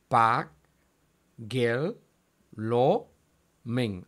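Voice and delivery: "pa, gel, lo, men" each set apart by a pause; syllable by syllable. Shown as a demonstration. Teacher dividing double consonants, "pa, gel, lo, men" syllable by syllable. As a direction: say "pa, gel, lo, men" as four separate pieces. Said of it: "Ahí tenemos doble división de consonantes".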